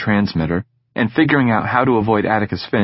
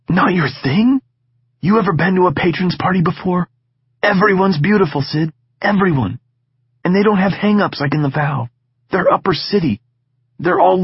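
higher, eager sales pitch; excited coaxing; 9 semitones higher than usual. Acted. Egan talking up the party to Sid, eager. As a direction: sell him on the party.

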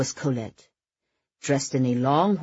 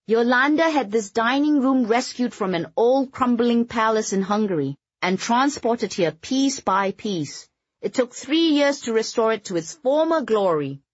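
proudly showing off, voiced higher; proud announcement; 8 semitones higher, 3 dB louder than usual. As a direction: announce it with pride. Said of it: "Wandi proudly announced".

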